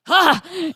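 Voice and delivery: sudden loud, high "Ha!"; startled shout, acted out; imitated sleep yell. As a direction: mimic a napper jolting awake with a yell.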